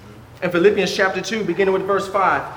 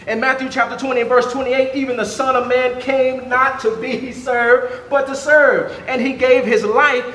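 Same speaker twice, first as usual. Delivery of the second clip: higher, louder sermon delivery; full-force preaching; 6 semitones higher, 5 dB louder than usual. Real, not acted. Preacher telling the church Christ came to serve, emphatic preaching. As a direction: drive the point home to the congregation.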